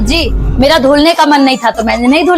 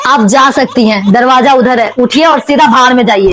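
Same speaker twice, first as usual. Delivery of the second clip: normal talking voice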